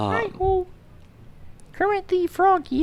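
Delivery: high frog puppet voice; bright puppet frog greeting; Kermit the Frog. Shown as a demonstration. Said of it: "I used to do Kermit pretty well".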